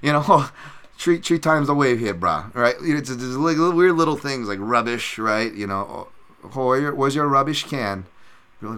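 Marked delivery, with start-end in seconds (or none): 0.3-2.5 pidgin "three" said as "tree"; lightly put-on Hawaiian pidgin, raised pitch; Hawaiian pidgin accent. Shown as a demonstration. Showing Hawaiian slang to listeners, light pidgin accent. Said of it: "with our weird accents, we pronounce three". 6.4-8.1 lightly put-on island pidgin; Hawaiian pidgin accent. Shown as a demonstration. Islander asking for the rubbish can, pidgin accent. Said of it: "with our weird accents".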